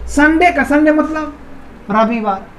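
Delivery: ordinary talk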